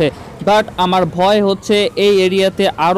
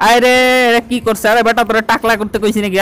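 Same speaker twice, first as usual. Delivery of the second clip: loud, higher furious yelling at the barber; angry outburst; 5 semitones higher, 3 dB louder than usual. Acted. To the barber who made him bald, a loud angry yell. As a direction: shout in outrage, arms up.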